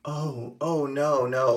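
soft, half-hearted mock protest. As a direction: play at resisting without meaning it. Acting out McNulty's feeble protest.

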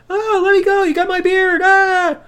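high, loud put-on yelp of a man grabbed by the beard; mock panicked flailing. Daniel Bryan pulled by the beard, high mock panic. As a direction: squeal as if someone's yanking your beard. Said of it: "Daniel's flailing around like".

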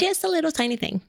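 tiny high-pitched voice, quick; mock meekness. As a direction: squeak it out as a timid little plea. Hamming up meek man's request.

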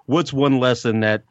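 normal talking voice

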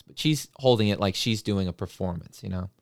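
usual speaking voice

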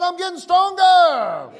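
high, drawn-out preacher's shout; rallying shout. Shown as a demonstration. Preacher calling somebody to shout they are getting stronger, rallying shout. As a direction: lead the crowd in a triumphant shout. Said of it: "Somebody shout".

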